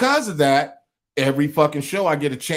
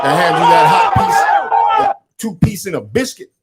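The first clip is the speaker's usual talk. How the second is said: loud bravado; 9 semitones higher, 8 dB louder than usual. Real, not acted. Host boasting to co-host, loud and high. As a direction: belt out the boast.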